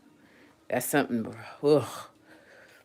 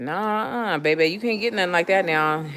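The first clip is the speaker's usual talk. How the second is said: dad refusing; 7 semitones higher, 4 dB louder than usual. Retold or imitated. Voices dad refusing an iguana.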